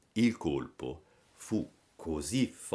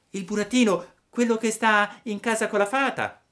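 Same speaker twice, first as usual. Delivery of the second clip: acuto e forte; burattino; 14 semitones higher, 6 dB louder than usual. A narrator's reading. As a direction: spiega in fretta, alzando la voce. Pinocchio spiega. (Pinocchio spiega alla lumaca, acuto e forte)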